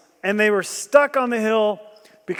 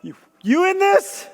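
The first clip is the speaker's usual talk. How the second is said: higher, fast, urgent pleading; urgent plea to God; 6 semitones higher than usual. Retold or imitated. Jehoshaphat crying out to God, urgent and high. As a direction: beg God quickly, pitch climbing. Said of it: "stopped everything and said".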